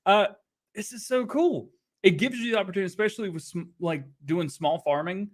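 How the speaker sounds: ordinary delivery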